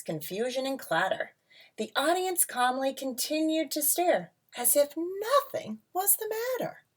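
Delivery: lilting read-aloud storybook voice; rhyming picture book read to a class; reading to children. A narrator's reading.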